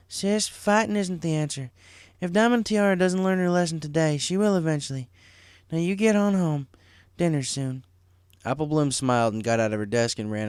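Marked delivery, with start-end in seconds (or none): 0.1-7.8 put-on a little higher, gently firm older sister; a hint of a caring big sister; lightly voiced big sister. A narrator's reading. Big sister settling little sister's fight, lightly voiced.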